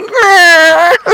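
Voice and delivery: very high, loud squeal for the cat; cat's voice. Retold or imitated. Voicing his cat refusing, high squeal. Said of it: "My cat's like".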